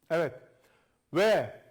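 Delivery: ordinary talk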